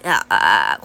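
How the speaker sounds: low crackling vocal fry; fry the ah low and creaky; edge voice. Shown as a demonstration. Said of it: "この声。". (ブツブツしたエッジボイス)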